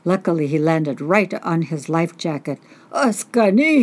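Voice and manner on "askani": high, loud frightened shout; startled cry. Acted. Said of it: "he cried".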